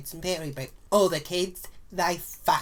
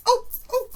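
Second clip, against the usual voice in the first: very high, loud yelps of pain; 5 dB louder than usual. Retold or imitated. Stirring hot coffee with a finger, high yelps of pain. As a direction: yelp as if scalded.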